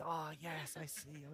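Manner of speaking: very soft, trailing acted reply; hushed agreement. Trinity member agreeing about his loves, hushed. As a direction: let it sink away to almost nothing.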